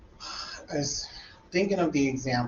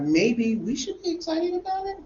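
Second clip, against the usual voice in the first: much higher, lightly voiced students catching on; voicing students; 13 semitones higher than usual. Teacher voicing the students catching his energy, higher. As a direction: play the class warming up.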